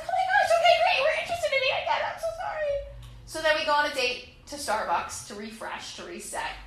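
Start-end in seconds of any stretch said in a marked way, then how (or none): 0.0-2.7 very high, gushing apology; hammed-up high-pitched gushing; squeaky overeager relief. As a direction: squeal like a desperate girlfriend.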